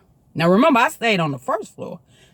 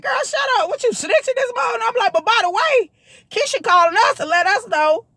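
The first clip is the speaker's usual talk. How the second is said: much higher, sassy retort; snapping at sister; 14 semitones higher than usual. Retold or imitated. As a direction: shut the snitching sister down.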